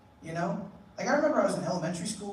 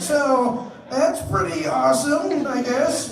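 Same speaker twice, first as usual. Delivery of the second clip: loud forced catchphrase; 7 semitones higher, 6 dB louder, much slower than usual. Retold or imitated. Catchphrase said louder, exasperated.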